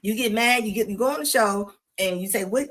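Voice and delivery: usual speaking voice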